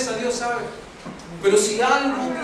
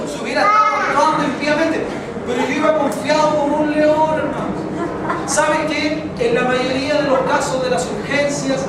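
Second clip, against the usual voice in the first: loud, raised preacher's voice; preaching; 5 semitones higher, 5 dB louder than usual. Acted. The pastor preaches to the congregation about trusting God, loud and raised. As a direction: drive the lesson home.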